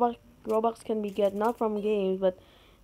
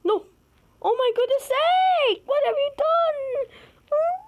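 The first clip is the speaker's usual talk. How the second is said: high, slow, loud wail; high dragged-out indignant shriek; outraged dismay; 4 dB louder than usual. Real, not acted. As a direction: cry out at the disaster. Outraged at game fail.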